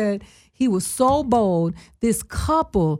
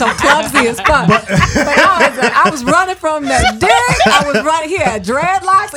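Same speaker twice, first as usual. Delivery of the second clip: laughing; 5 dB louder than usual. Real, not acted. Telling friends how she fled, laughing. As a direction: laugh at yourself running away. Laughing at her own story.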